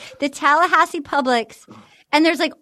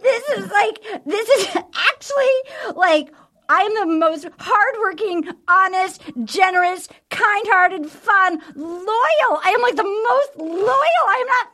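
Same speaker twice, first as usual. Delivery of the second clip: higher, hammed-up breathless self-praise; mock hyperventilating rant; 5 semitones higher than usual. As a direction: imitate a contestant spiralling while hyperventilating, pitch up. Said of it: "she's, like, hyperventilating".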